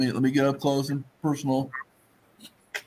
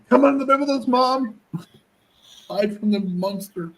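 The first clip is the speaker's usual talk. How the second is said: high little cat voice, slowing at the end; a cat begging mom to hide with it; timid cat; 11 semitones higher than usual. Pretend.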